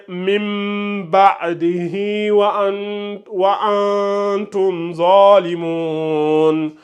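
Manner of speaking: mim ba'dihi said slowly with the hi carried into wa antum zalimun; connected recitation. Shown as a demonstration. Teacher showing Rebecca the continued reading. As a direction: chant it slowly, linking the hi. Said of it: "quand on continue".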